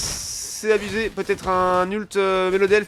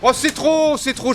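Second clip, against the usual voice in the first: excited shout; 7 semitones higher than usual. Real, not acted. Teamfight climax call.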